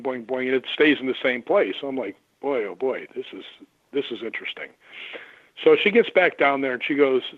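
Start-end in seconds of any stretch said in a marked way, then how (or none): 2.4-4.7 low, soft murmur to himself; half-voiced under the breath, stunned; a hushed boy oh boy; quiet amazement. Private reaction to the photo.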